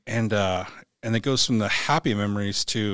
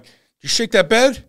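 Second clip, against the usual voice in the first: high, raised-voice accusation; loud accusing question; 11 semitones higher, 10 dB louder than usual. Retold or imitated. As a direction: demand it loudly in disbelief. Retells confronting the doctor.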